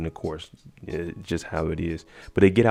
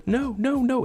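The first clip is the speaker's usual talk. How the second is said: mock scream. Captive Cora protesting as she is locked in, mock scream. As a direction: imitate a scream without the volume. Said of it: "Cora's in here screaming and stuff".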